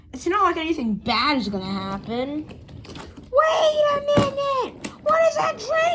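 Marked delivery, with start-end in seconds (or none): 3.3-6.0 high, startled shout; alarmed shout. Acted. Character spots danger.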